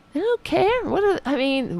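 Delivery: high, raspy exasperated rise. Real, not acted. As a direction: let your voice climb and rasp. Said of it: "why is my voice getting higher and higher and also raspier".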